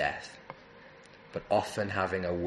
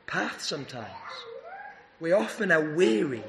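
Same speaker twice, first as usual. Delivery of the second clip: rising, emphatic sermon voice with pushes on key words; fervent preaching; 5 semitones higher than usual. Acted. Preacher urging his congregation to go to Jesus, fervent preaching. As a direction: preach it to the weary, building and falling back.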